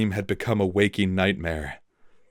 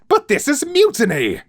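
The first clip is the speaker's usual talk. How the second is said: high, loud outraged shout; captain bellowing at the mutineer, outraged; 9 dB louder than usual. A narrator's reading. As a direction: bellow the accusation. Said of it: "he bellowed".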